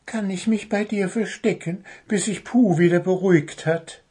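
high little bird voice, timid request; wary little bird seeking shelter; cautious. A narrator's reading. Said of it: "fragte der kleine Vogel vorsichtig".

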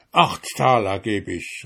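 ordinary delivery

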